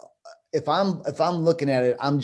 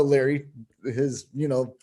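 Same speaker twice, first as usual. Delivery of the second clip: ordinary delivery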